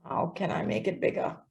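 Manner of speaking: faint, almost whispered; barely audible. Real, not acted. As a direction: voice dropping away to nothing. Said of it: "Your sound is very low".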